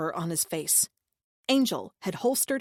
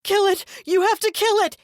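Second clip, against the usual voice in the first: very high, loud scream; 14 semitones higher, 9 dB louder than usual. A narrator's reading. Deluded brother screaming to kill. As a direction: shriek the order. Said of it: "he continued to scream".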